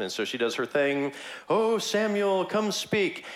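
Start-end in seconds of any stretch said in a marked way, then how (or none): 1.5-3.2 high, hammed-up medium calling out; mock summoning. Witch summons Samuel in a high, theatrical call. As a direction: call up the dead like a showy witch.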